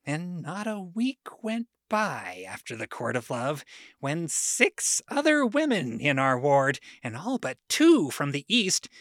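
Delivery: ordinary delivery, nothing marked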